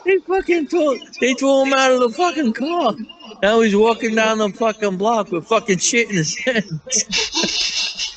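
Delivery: high, laughing through the punchline; telling his friend the funniest part, laughing; laughing storytelling. Real, not acted. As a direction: crack up while telling it. Telling climax of funny story.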